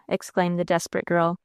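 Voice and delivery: ordinary delivery, nothing marked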